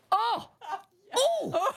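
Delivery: high swooning "oh!"; hammed-up, high-pitched swoon; swooning squeal. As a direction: squeal as if overcome with desire. Swooning over the sexiest words.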